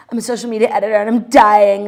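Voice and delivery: loud mock-dramatic whine; mocking quote. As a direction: ham up the try-hard joke.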